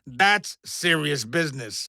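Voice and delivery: ordinary talk, nothing marked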